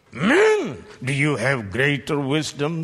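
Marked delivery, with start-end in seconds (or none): 0.1-0.8 high, loud call of Men. Acted. Preacher calls out to the men before challenging them. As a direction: call out Men to get their attention.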